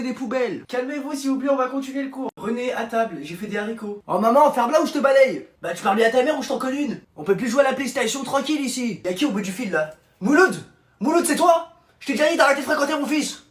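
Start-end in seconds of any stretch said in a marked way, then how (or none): 4.1-5.5 loud, rude snap at mum; angry yell. Acted. Son yelling at his mother over dinner, loud and rude. 10.2-13.4 higher, loud, fast scolding; angry mother yelling. Acted. Mother yelling at her son's friend on the phone, loud and high.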